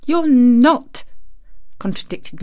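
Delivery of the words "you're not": louder, higher, blunt denial; unsympathetic contradiction. A narrator's reading. Girl dismissing the boy's talk of dying, unsympathetically. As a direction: contradict him without any sympathy.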